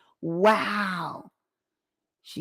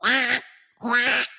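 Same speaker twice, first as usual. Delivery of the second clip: duck quack; 2 semitones higher than usual. Acted. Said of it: "quacking like a duck".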